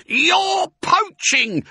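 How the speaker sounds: high, loud, stretched accusation; outraged shriek. A narrator's reading. Rat catches them drinking, shrieking. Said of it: "shrieked Rat".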